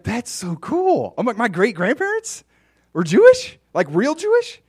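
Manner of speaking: high, loud gushing amazement; excited amazement. Retold or imitated. Retelling his own gushing reaction, high and loud. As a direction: gush like it's the coolest news ever.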